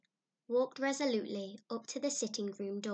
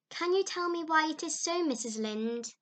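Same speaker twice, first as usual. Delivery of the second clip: put-on higher, louder, earnest girlish lament; Anne, higher and pleading; eager young girl voice; 4 semitones higher, 7 dB louder than usual. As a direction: play a dramatic young girl pouring out woes. Anne's lament to Mrs Lynde.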